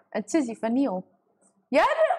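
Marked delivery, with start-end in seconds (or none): 1.7-2.1 squeal. Real, not acted. Sudden squeal at chat.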